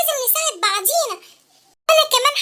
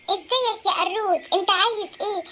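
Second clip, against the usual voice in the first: put-on a lower, taunting big kid; bullying kid voice; 6 semitones lower, 4 dB quieter than usual. Bully taunting the short kid, low and slow. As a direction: sneer down at the little one, slow.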